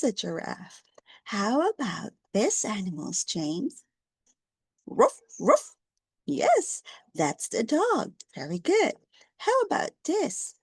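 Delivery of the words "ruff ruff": loud, higher mock bark; dog bark. Shown as a demonstration. Teacher barks like a dog for James.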